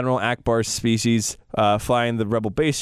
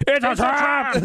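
much higher, hammed-up alarmed cry; Admiral Akbar; 10 semitones higher, slower than usual. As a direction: ham up the famous warning. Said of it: "infamous line of Admiral Akbar going".

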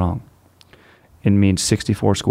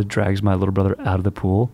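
ordinary talk, nothing marked

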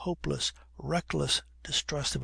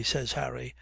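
usual speaking voice

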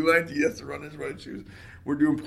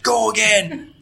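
coach yelling; 10 semitones higher, 12 dB louder, slower than usual. Retold or imitated. Coach barking orders at practice.

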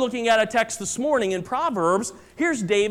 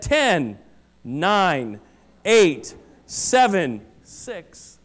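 slow countdown fading to a whisper; counting down; 3 semitones lower, much slower than usual. Acted. Doctor counting down the seconds for the patient, slow and fading. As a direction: count it down, slower, last number whispered. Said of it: "and he said".

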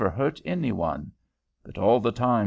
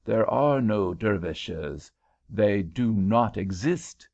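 slow, each syllable set apart; syllables separated; slower than usual. Acted. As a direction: spell it out slowly like a careful Frenchman. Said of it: "separating his syllables as a Frenchman will".

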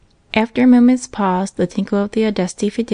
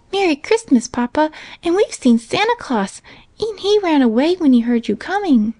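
put-on high, eager child's chatter; a small girl rushing to tell her father everything; little girl voice; 9 semitones higher than usual. A narrator's reading. Little girl telling her father about Santa, excited.

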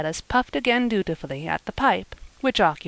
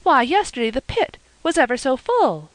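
excited; 6 semitones higher than usual. A narrator's reading. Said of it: "she exclaimed".

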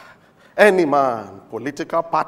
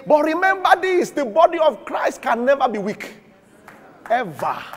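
normal talking voice

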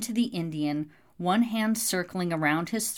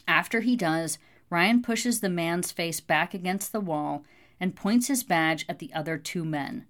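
plain reading voice

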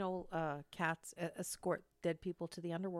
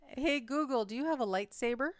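louder, clear question to the speaker; repeating to voice assistant; 5 semitones higher, 5 dB louder than usual. Real, not acted. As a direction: ask the machine again, clearly.

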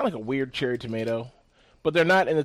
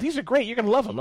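cheery host voice; 10 semitones higher, faster than usual. Retold or imitated. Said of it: "she was just giving me handfuls".

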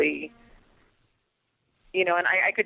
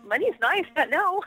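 high dismissive reply; 11 semitones higher than usual. Retold or imitated. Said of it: "And I'm like".

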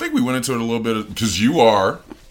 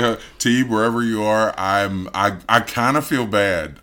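usual speaking voice

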